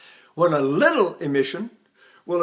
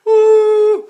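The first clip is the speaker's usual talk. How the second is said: very high, much louder, slow ooh; slipped falsetto ooh; 12 dB louder than usual. Shown as a demonstration. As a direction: let the breath slip into falsetto. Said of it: "how do I do falsetto".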